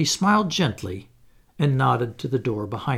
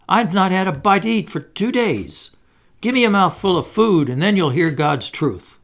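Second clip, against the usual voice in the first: high, louder pleading whine; beggar's whine; 5 semitones higher, 4 dB louder than usual. Acted. Hannay begs the old gentleman for food, whining. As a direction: whine like a starving tramp begging. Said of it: "I said in a real beggar's whine".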